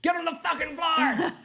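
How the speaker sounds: high, full-volume robbery command; bank robber's shout. Acted. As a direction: bellow it like an armed robber.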